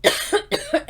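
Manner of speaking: real coughing fit while apologizing; choking cough. Real, not acted. Reactor choking mid-explanation, coughing and apologizing. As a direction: cough through a quick apology. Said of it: "Sorry, I'm choking".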